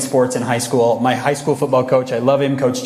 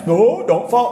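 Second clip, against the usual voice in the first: mock taunting friends; 8 semitones higher, slower than usual.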